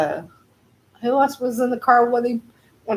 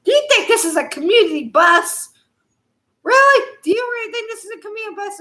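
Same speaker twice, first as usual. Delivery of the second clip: higher, teasing mock Jericho voice; quoting Jericho with a laugh, pitched up; imitating Jericho; 11 semitones higher, faster than usual. Retelling Jericho teasing Cornette, mock incredulous. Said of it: "He goes, he said".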